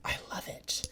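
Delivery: breathy whisper of delight; barely voiced, hushed and admiring. Real, not acted. Whispered praise of the perfume.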